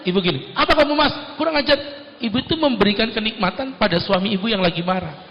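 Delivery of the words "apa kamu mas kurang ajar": higher pitched, sharp comeback; wife hitting right back at him; mock angry wife. Wife answers her angry husband, mock furious.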